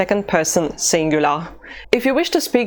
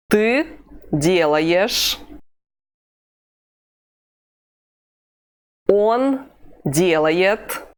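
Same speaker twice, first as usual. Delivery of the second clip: very slow, deliberate phrases with long gaps; slow repeat-after-me drill; much slower than usual. Shown as a demonstration. Teacher drilling the class slowly before they repeat. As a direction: say each form slowly for copying. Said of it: "So repeat after me".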